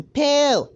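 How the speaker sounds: high, loud imitated zap; shooting sound effect. He's going with the sparkly wand, high loud pew. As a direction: fire it off as one sharp burst.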